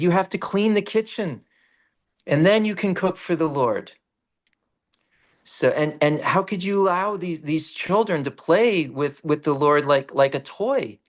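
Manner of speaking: high, preachy reprimand; indignant scolding. Retold or imitated. Saint rebuking the family for offenses, high and indignant. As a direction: lecture them like a scandalised elder.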